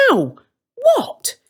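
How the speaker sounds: put-on high startled boy yelp; Hansel yelping. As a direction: cry out after a kick to the shin. Hansel kicked under table.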